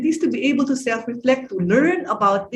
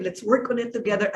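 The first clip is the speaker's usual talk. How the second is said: soft; 3 semitones lower, 7 dB quieter than usual. Shown as a demonstration. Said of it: "you're more directive".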